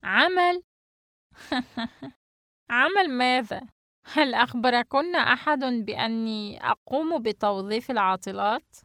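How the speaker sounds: high, mocking laugh through the question. Hostess answering girls who want work, laughing. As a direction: laugh in disbelief at the request. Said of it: "أم حسن ضاحكة".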